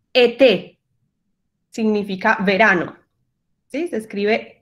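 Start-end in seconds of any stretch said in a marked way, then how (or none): 0.1-0.8 "Été" with two closed E sounds, as in Spanish; French closed E. Shown as a demonstration. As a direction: model "Été" as a separate French word. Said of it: "palabras en francés con esta E". ("Été" con la misma E del español)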